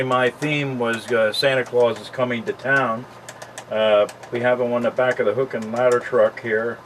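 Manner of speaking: ordinary talk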